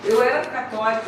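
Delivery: usual speaking voice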